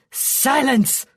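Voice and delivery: commanding yell; shouted command. A narrator's reading. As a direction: cut her off with a shout. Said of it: "Relstra shouted at her".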